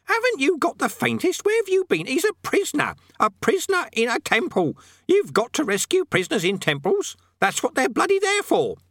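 very high, scolding parrot voice; squawking, indignant parrot; parrot, exasperated. Acted. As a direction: berate him like an angry bird.

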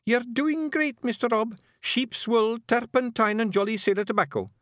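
very high, bright little Scots voice; Billy flatters Rob, high-pitched; eager appeasing. A narrator's reading. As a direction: praise Rob to save his pride. Billy soothes Rob, rereads.

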